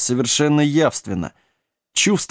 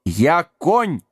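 understated offended protest, pitch raised; offended roar; 4 semitones higher than usual. A narrator's reading. Horse corrects the giant, offended. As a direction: insist on it, hurt pride. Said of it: "взревел обиженный до глубины души".

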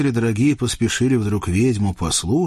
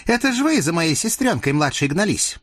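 казачка; 8 semitones higher than usual. Acted. Казачка обвиняет чертей.

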